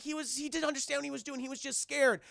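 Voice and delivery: put-on high, quick kid's protest; pleading boy. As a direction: imitate a boy defending his friend. Boy defending robot.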